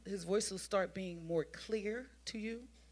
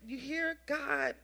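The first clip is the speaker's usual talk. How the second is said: ordinary talk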